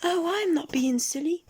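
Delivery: very high squeaky protest; little goblin voice. A narrator's reading. Goblin denying he is silly, high squeak. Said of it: "said the goblin".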